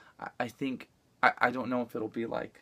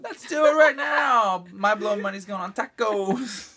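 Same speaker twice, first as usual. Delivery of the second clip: louder, higher and slow, eager for tacos; mock eager; 8 semitones higher, 7 dB louder, slower than usual. Husband hams up craving tacos in a loud, eager, slow voice. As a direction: ham up an eager craving for food. Husband joins the food imitation.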